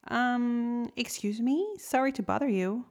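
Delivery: small creature politely interrupting; tiny little voice. Acted. Tiny shrimp timidly tugging the lobster's antenna, small voice. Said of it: "a tiny little voice".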